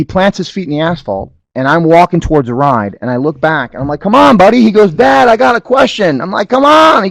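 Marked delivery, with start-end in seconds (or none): 4.0-4.6 put-on loud call to a lagging kid. Calling son to keep walking. 4.9-6.2 put-on high little-kid voice; small son tugging at dad; child's voice. Voicing small son in story. 6.5-7.0 high, loud impatient call. Retold or imitated. Calling son again.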